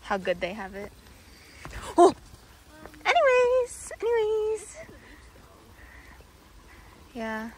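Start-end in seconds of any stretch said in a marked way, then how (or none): 2.0-4.8 high, loud sing-song brush-off; exaggerated brush-off. Real, not acted. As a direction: sing it to change the subject. Awkward moment brushed off.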